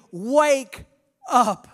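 preacher voicing Jesus, calling loud. Jesus voiced urging the church.

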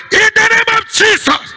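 high, ringing shout of faith; prayer climax. Real, not acted. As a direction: shout the declaration to the congregation. Prayer climax before the congregation.

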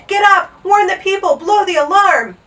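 loud high alarm shouts; urgent shouting. A narrator's reading. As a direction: shout a sleeper awake. Rousing a sleeping trumpeter in alarm.

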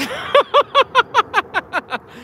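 rapid, high-pitched cackling laugh; real laughing fit; long high giggle. Real, not acted. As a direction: giggle on and on until it fades. Laughs about looking for treasure.

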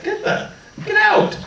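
high mock shove-off; playful imitation of annoyed classmates; mock get out. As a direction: shoo him out in a high put-on voice. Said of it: "But now it's like".

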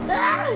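sharp cry of annoyance; frustrated yelp. Real, not acted. Frustrated at game birds.

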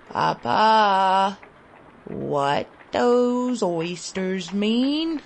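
put-on a faint, drawn-out plea from a starving boy; slow, strained words from a weak child; weak child's plea. A narrator's reading. Starving boy asks his father again, slowly and weakly. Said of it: "I repeated".

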